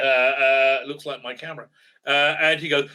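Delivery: ordinary talk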